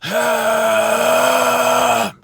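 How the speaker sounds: very long, loud held rasp; throat-clearing scream. Shown as a demonstration. Vocal coach showing how to start, sustained throat-clearing scream. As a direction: hold a high harsh exhale until it tails off. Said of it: "like, clearing your throat".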